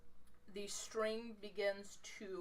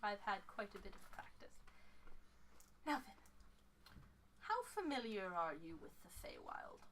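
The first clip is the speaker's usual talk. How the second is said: soft, measured, ending whispery; older fey woman; 8 dB quieter than usual. Acted. Fey woman questioning the visitor, softly voiced. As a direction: leave long pauses and drop to a hush. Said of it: "and she goes".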